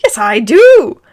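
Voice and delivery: high, loud indignant screech; hammed-up screeching aunt. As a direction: screech it back defiantly. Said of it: "Aunt Petunia screeched".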